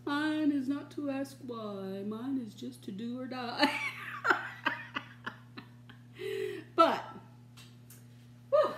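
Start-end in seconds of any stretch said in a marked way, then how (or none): 3.8-5.0 high squeaky giggle. Real, not acted. Laughs at her own saying.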